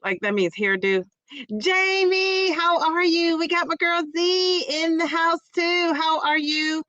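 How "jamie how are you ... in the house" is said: high, sung-out hello; excited greeting. Real, not acted. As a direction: call the name out with delight.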